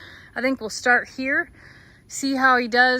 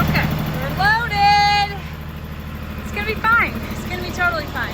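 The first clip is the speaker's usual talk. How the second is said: high, sing-song; 7 semitones higher than usual. Real, not acted. Reassuring self on bumpy tractor.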